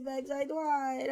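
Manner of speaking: put-on slow pouting baby voice, "tried" said as "twied"; whiny baby talk. Streamer pouting to Max after failing, slow baby-talk whine. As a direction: plead like a sulky little kid.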